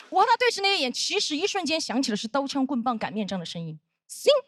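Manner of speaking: normal talking voice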